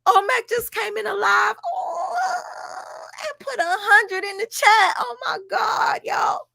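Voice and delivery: mock squealing excitement, loud and very high; imitating an overexcited streamer calling to mom; hammed-up shrieky excitement, pitch way up; Velvet. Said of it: "Velvet showed so much excitement".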